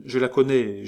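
plain reading voice

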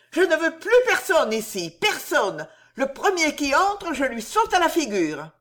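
high, loud fierce warning; a mother guarding her child from everyone; high loud angry threat; furious threat. Acted.

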